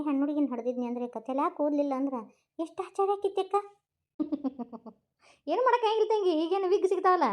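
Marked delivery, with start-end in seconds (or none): 4.2-4.9 quiet amused giggle; soft chuckle. Acted. Laughing about the bald baby. 5.5-7.3 high-pitched, laughing reassurance; amused, giggly teasing. Acted. Joking about wigs for bald baby.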